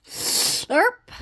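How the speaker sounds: slurping sound. Acted. Frog eats the fly, noisy slurp. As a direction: suck it in noisily.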